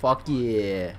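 ordinary talk, nothing marked

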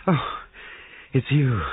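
sudden relief on seeing who it is; relieved. A narrator's reading. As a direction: let out a relieved "oh". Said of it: "she said with relief".

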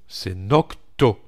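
slow right down and insist on "Nocto"; slow careful correction. Shown as a demonstration. Tutor firmly fixes his own slip, slow and deliberate. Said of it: "comment est-ce que je dis Notco?".

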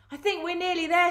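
fast, high excited crook voice; eager crook. A narrator's reading. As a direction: rush it out high with anticipation. Crook eager while tunnelling.

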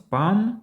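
"palm" said without L; silent L. Shown as a demonstration. Teacher introduces the word palm, silent L. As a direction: say "palm" as long pahm. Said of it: "тут також L не вимовляємо".

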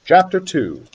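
usual speaking voice

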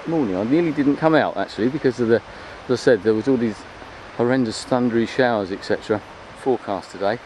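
ordinary delivery

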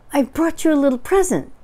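high, loud, eager voice; Pooh, excited. A narrator's reading. Pooh brings Eeyore a present, high and excited. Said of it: "said Pooh excitedly".

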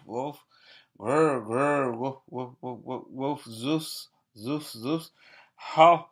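woofs, growls, laughs and arfs, playful; dog barking noises. Acted. Reading the dog sounds to children, barking playfully. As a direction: bark and yip like a playful dog.

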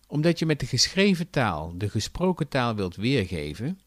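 usual speaking voice

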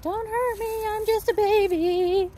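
high, pleading little puppy voice; scared puppy voice. Retold or imitated. Trainer voicing the anxious puppy, high and pleading. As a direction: beg like a frightened baby dog. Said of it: "doing puppy appeasement stuff".

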